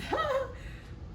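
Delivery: a quick high giggle. Real, not acted. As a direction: laugh lightly at the silly line. Laughs at burping.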